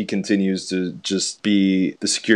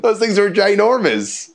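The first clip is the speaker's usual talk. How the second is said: amazed; 11 semitones higher, 5 dB louder than usual. Real, not acted. Amazed at biceps.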